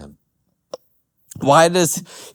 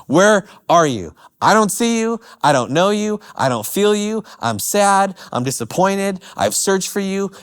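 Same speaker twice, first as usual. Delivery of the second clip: anguished psalmist; 7 semitones higher than usual. Retold or imitated. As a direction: cry out to an absent God in despair. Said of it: "Basically saying".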